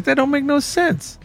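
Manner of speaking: incredulous husband. Acted. Husband disputes wife in told joke.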